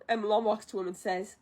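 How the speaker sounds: usual speaking voice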